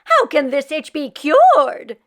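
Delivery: put-on fretful old woman voice; Meshka voice. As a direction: beg the rabbi for a cure. Meshka frantic to the rabbi.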